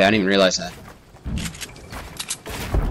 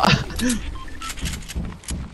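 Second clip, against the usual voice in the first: breathy panicked laugh; 11 semitones higher, 10 dB quieter than usual. Real, not acted.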